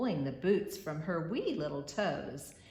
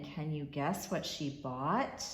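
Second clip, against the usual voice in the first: ordinary delivery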